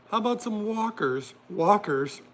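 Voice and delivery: high mock-British voice; attempted British accent. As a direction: try a playful, bad British accent. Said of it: "I can't do my very British accent".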